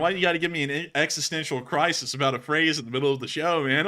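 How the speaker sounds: ordinary talk, nothing marked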